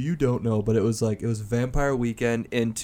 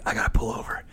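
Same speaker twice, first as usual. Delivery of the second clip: whispered aside; faster than usual. Retold or imitated. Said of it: "I'm like".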